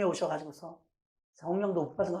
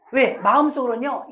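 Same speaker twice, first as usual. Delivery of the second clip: usual speaking voice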